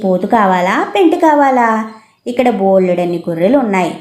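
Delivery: ordinary delivery, nothing marked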